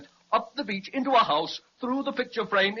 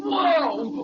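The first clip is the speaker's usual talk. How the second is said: startled; 9 semitones higher, 4 dB louder than usual. Acted. Dragon wakes when tweaked.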